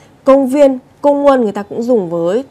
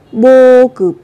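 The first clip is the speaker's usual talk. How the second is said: loud; 2 semitones higher, 6 dB louder than usual. Shown as a demonstration. Whispered then loud model word.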